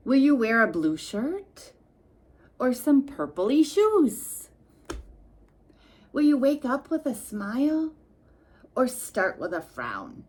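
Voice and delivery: playful reading. Acted.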